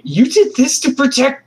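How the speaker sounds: put-on very high, slow child's voice; boy turns on the killers; disbelieving boy. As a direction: draw it out in disbelief. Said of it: "the boy is gonna turn his head and say".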